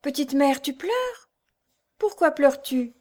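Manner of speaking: put-on high-pitched child's voice, questioning; narrator's piping little-boy voice. A narrator's reading. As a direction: ask like a small son worried for his mother. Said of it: "fit-il en tendant les bras".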